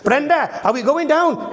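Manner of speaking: high, broad Welsh farmer. Shown as a demonstration. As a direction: farmer Mr Reese calling the store in a Welsh accent. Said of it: "Mr Reese asks Brenda".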